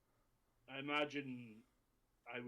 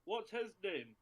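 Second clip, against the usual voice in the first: calling out; 7 semitones higher, 7 dB louder than usual. Acted. Player calls out to group.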